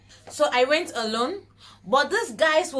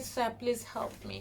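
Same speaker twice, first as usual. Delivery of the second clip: tearful plea; 9 dB quieter than usual. Retold or imitated. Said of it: "I started crying".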